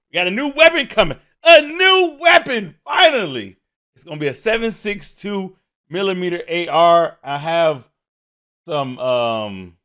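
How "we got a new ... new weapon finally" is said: excited shout. Real, not acted. Hyped about new weapon news.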